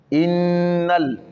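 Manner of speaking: Innal with the n held for two beats; Innal, n lengthened with shadda; doubled n. Shown as a demonstration. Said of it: "Il y a Shida sur le na".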